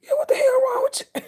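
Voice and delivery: high, loud mocking yell; mock shout. Retelling how he got yelled at, high loud mock shout. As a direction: throw it back high and loud like the scolding he got.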